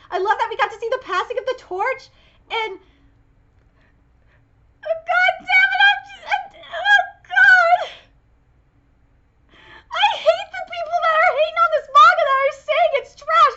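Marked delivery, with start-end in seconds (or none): 4.9-13.5 high, loud cries through laughter and tears; crying outburst. Real, not acted. Fan choking up over the manga's haters, tearful and loud. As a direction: break down while still talking.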